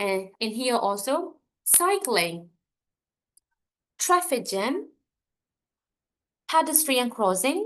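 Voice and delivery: teacher drilling compound nouns, first word hammered; first-word stress drill. Shown as a demonstration. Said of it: "ပထမစကားလုံးကိုဖိ".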